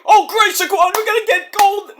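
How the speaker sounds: high, loud, put-on cheery voice; mocking Dion. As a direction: mimic him with fake enthusiasm. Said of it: "He's like".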